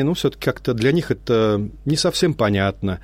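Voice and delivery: normal talking voice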